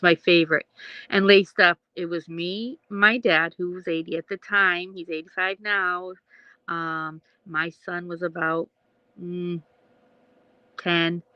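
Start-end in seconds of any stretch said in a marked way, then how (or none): none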